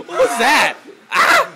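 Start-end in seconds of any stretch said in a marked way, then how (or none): 1.1-1.5 high loud yell; yelling out in hype; excited shout. Real, not acted. Reacting to a hit.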